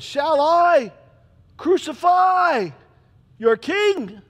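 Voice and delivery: high, loud, slow on "crucify"; shouted challenge. Acted. Pilate shouting at the crowd, high and loud. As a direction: hurl the question at the mob.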